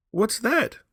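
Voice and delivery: high, loud alarmed question; old woman woken by a knock; startled cry. A narrator's reading. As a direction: jolt up in alarm. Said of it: "cried the old woman".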